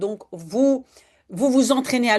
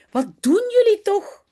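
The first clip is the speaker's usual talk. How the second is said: surprised mother; 8 semitones higher than usual. Acted. Mother finds children sliding.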